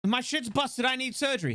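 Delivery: high, hammed-up complaint; mock patient distress. Injured patient begging for real help, high mock distress. Acts a patient needing surgery.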